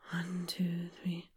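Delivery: low whispered counting. Shown as a demonstration. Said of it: "breathe in for three and out for three".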